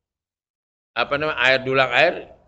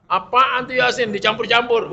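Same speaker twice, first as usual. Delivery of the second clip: scoffing question, pitched higher; mocking critic; 8 semitones higher, 5 dB louder than usual. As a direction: mimic a sneering outsider. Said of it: "Begitu ngomongnya". (menirukan pengkritik yang mengejek, nada tinggi)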